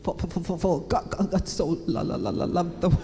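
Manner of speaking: mock stutter, sticking on each first syllable; exaggerated stuck-record stammer while reading aloud; nervous stammered reading. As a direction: imitate someone stumbling nervously through scripture. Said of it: "He was just nervous to read the Bible".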